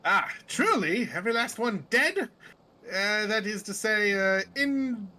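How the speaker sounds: put-on genteel undead emissary with British accent. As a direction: recount it grandly in a British accent. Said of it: "I gave him a British accent".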